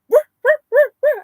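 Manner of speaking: high, loud woofing; imitated dog bark. Copying her dog barking at a ghost, loud and high. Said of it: "And he started".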